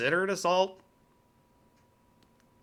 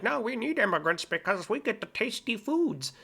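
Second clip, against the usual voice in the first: higher, sing-song mimicry of a smug argument; mocking quote; 7 semitones higher than usual. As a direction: parody the people who say it, a bit higher. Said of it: "say the same thing, too, where it's like".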